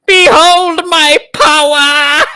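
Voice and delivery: high, slow booming proclamation; pompous booming ogre mage. Acted. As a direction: proclaim grandly, very slowly. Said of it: "he goes".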